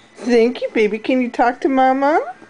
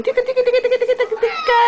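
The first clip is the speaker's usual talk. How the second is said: high laughing ticka chant; giggly tickle attack; 8 semitones higher, much faster than usual. Real, not acted. Mother tickling baby.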